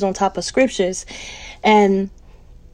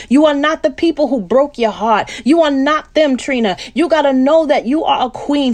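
God's reassurance; 3 semitones higher than usual. Retold or imitated. God speaking to her.

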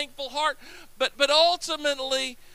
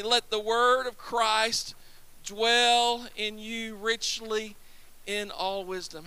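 slower still, each phrase held; slow recitation; 3 semitones lower, 4 dB quieter, slower than usual. Shown as a demonstration. Preacher repeating the verse with the congregation, slower. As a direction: draw out every word for them to follow. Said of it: "say it with me".

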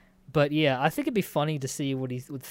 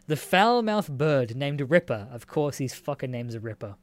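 ordinary talk

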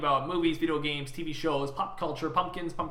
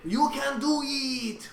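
slow, high cheering shout; hyped-up cheer; 9 semitones higher, much slower than usual. Pretend. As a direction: cheer them on like a hype man. Echoing cheer, slow and higher.

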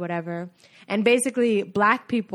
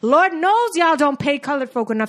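impassioned, higher-pitched movie outburst; theatrical indignant speech, pitch raised; Hidden Figures monologue; 5 semitones higher than usual. Acted. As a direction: plead your case like a fed-up film heroine. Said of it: "That's from Hidden Figures".

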